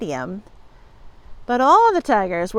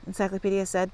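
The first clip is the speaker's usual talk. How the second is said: usual speaking voice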